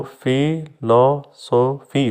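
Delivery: Philosophy slowly split as fi, lo, so, fi; four separate beats in philosophy; syllable by syllable. Shown as a demonstration. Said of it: "four है". (Philosophy के चार syllable अलग-अलग, धीरे)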